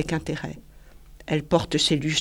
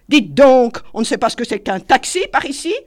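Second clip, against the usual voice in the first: higher, louder lordly demand; haughty; 6 semitones higher, 7 dB louder than usual. A narrator's reading. Paul hailing the waiter, haughty loud demand. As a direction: talk down to the waiter. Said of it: "avec hauteur".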